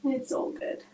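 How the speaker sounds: whispered aside to herself; barely voiced reassurance. Real, not acted. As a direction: let it out on breath, no voice. Self-reassurance after laughing.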